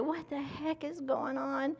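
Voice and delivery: put-on high, bewildered baby voice, rising into a wail; mock newborn baby. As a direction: play a confused newborn crying for mommy. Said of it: "They're like".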